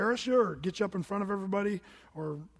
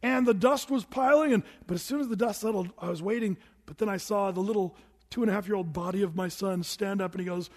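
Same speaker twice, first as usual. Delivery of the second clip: ordinary delivery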